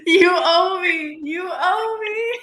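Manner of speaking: high, slow, loud laughing cry of you owe me; laughing mock wail. Friend repeats uncle's line, laughing and wailing loud. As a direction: hams up the uncle's demand through laughter.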